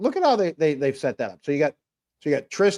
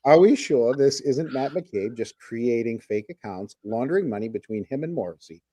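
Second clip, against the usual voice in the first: measured repeat after being caught mumbling; clear re-read. Real, not acted. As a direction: read it out properly, word by word. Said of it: "mumbled the rest".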